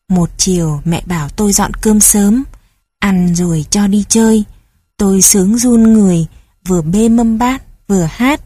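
ordinary delivery, nothing marked

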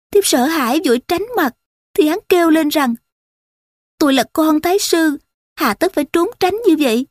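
high-pitched, acted plaintive account; tearful lament. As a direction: plead innocence as a wronged woman in distress. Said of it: "Nàng khóc nấc lên, rồi kể lễ".

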